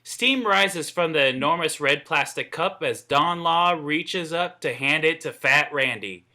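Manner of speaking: ordinary talk, nothing marked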